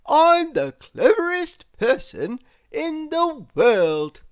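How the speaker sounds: a very pleased little man crowing about himself; boastful Mister Clever. Acted. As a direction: puff yourself up, drawing out every word.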